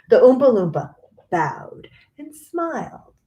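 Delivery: ordinary delivery, nothing marked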